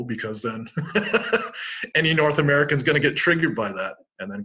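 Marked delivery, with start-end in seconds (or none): 0.7-1.9 short airy chuckle; quick, high chuckle; amused laugh. Real, not acted. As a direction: laugh mid-sentence at the absurdity. Laughs at North Americans getting triggered.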